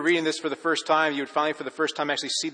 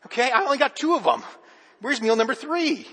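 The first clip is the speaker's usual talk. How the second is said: high, pleading kid voice, mildly hammed-up; mock a hungry twelve-year-old complaining; whiny boy; 9 semitones higher, 4 dB louder than usual. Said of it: "you're like".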